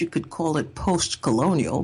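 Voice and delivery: ordinary talk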